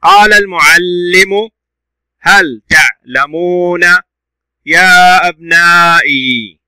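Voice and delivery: slow, loud syllables with long vowels held in يا أبنائي; deliberate classroom demonstration reading; model reading. Shown as a demonstration. Teacher models the sentence reading.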